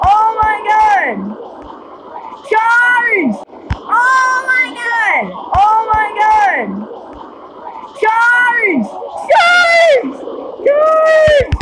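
high, stretched-out cries; excited battle cry. Real, not acted. Urging troops on in battle.

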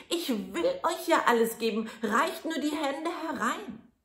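high, loud yell; shout as loud as he can. Acted. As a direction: shout so the maid hears. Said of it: "schrie Daumestick noch einmal, so laut er konnte".

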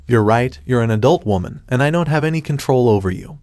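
ordinary delivery

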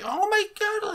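very high, whiny imitation. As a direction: whine it out way up high. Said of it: "He just has a bit of a whiny voice".

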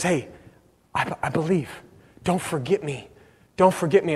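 soft, low, halting plea; hushed plea. Retold or imitated. Repentant thief to Jesus on the cross, hushed plea. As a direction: plead quietly, voice dropped right down.

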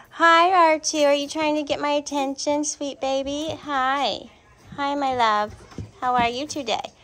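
greeting R2 warmly. Real, not acted. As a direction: call to him sweetly. Cooing loudly at puppy.

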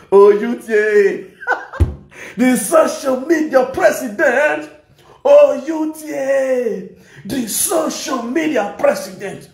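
slow, high, laughing mock praise; mock hailing. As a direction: hail him slowly with giggles. Mocking chant of a title, laughing.

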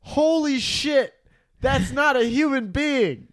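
high, drawn-out disbelief; real astonishment. Real, not acted. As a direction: exclaim slowly, pitch way up, aghast at a photo. Reacting to the politician's photo.

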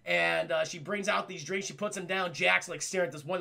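ordinary delivery, nothing marked

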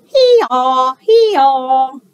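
loud hee-haw bray; donkey braying hee-haw. Acted. The donkey appears.

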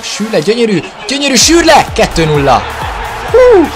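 loud, high goal scream; goal celebration. Real, not acted. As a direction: yell the scorer's name in joy.

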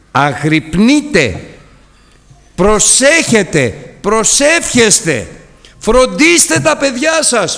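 slow exhortation. Real, not acted. Preacher exhorting the congregation.

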